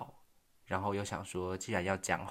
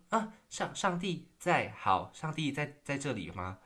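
baffled reply; 6 semitones higher than usual. Retold or imitated. Retold own baffled reply.

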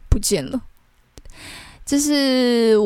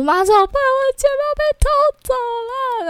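much higher, louder, near tears; panicked plea; 3 dB louder than usual. Retold or imitated. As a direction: replay the panicked call to mom, pitched way up. Panicked call to mom retold.

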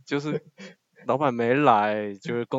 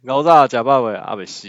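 loud, punchy Taiwanese-accented phrases; 9 dB louder than usual. Shown as a demonstration. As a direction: bark them out in Taiwanese accent. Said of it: "嗯".

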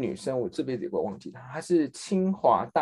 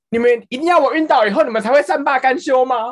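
high, loud dramatic outburst; retold outburst; 11 semitones higher, 9 dB louder than usual. Coworker in the kitchen yells at everyone, high and loud. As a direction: fling the line out in frustration. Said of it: "他说".

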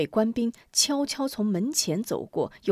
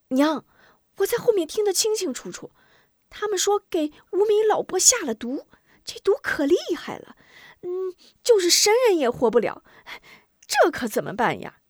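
put-on very high, urgent child voice; distressed boy; 10 semitones higher, 3 dB louder than usual. Boy tells mother of the poisoning, high frantic child voice. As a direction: pour out bad news in a high child's pitch.

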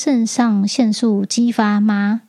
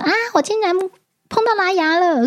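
mock scream; 10 semitones higher than usual. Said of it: "最强烈的那个是尖叫".

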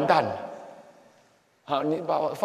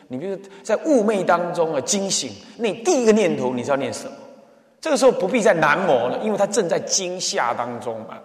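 normal talking voice

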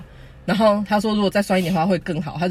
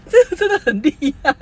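laughing; 11 semitones higher, 3 dB louder than usual. Real, not acted. Laughing at mom's bland noodles.

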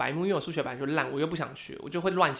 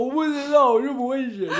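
mock crying; 8 semitones higher, 5 dB louder than usual. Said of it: "我就一直哭，我就说".

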